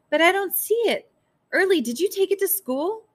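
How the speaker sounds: high, loud, agitated; frantic questioning. A narrator's reading. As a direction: blurt it out in mounting panic. Frantic search, questioning the child.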